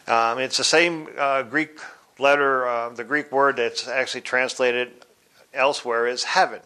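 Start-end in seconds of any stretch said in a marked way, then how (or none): none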